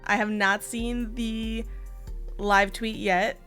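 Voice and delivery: normal talking voice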